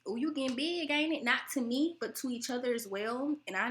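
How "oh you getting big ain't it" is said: higher, teasing relative's voice; mock nosy relative. Imitating family greeting each other with weight comments, teasing. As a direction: poke fun the way the aunties do. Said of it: "they be like".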